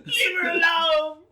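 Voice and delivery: high, very loud mock yell; pretend protective shout. Pretend neighbour defending Sharoma from her friends, shouting. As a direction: yell it down to the street.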